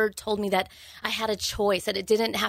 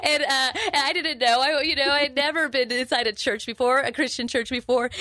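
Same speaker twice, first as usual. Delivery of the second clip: higher, louder, laughing through the words; 5 semitones higher, 3 dB louder than usual. Real, not acted. Telling the host about her first church night, laughing.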